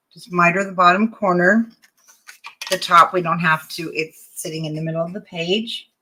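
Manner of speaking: ordinary talk, nothing marked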